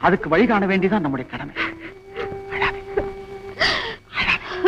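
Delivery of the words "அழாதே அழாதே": soothing. Acted. Consoling crying Seetha.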